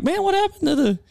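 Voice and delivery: very high, surprised exclaim; shocked. Retold or imitated. Diner to waitress at closed buffet, high shocked exclaim. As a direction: squeal in disbelief. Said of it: "and I'm like".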